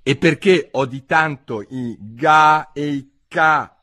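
ordinary delivery, nothing marked

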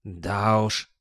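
resigned mutter; sighing grumble. A narrator's reading. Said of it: "пробурчал Гаспод со вздохом".

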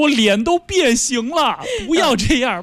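high, pleading cat begging to escape; squeezed cat crying let me out. Retold or imitated. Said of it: "猫说，我说". (被挤扁的小猫哀求的声音)

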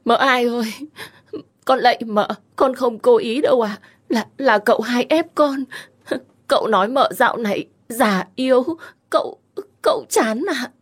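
wailing maid. A narrator's reading. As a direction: servant begging on her knees, faking tears. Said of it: "vừa đấm ngực vừa than vãn". (giọng con hầu than vãn, khóc lóc giả vờ)

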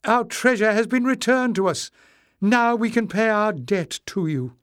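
high, loud elated proclamation; joyful cry. A narrator's reading. She rejoices to her rescuers, crying out high. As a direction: announce it with high-pitched joy. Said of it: "she cried".

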